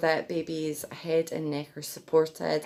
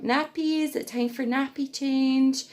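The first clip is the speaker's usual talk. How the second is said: put-on much higher sing-song voice for a baby; baby talk; 8 semitones higher than usual. Shown as a demonstration. Mum talking to her baby before a nappy change, high baby talk. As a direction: coo it up high to the infant. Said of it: "Before you change their bum".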